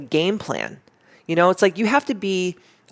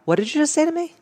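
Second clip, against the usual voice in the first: higher, challenging retort; powerful comeback; 12 semitones higher, faster than usual. Shown as a demonstration. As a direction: turn it back on them, daring. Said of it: "I just look at them and I go".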